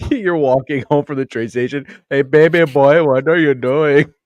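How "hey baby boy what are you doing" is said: loud, high, drawn-out leering call; exaggerated slow catcall; catcaller. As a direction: holler after someone like a mock street creep. Acts catcallers following him home.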